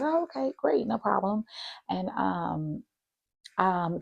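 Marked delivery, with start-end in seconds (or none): 0.0-1.4 high, bright phone-politeness; mock-sweet high reply; own polite voice. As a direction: sound breezy and agreeable. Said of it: "I said".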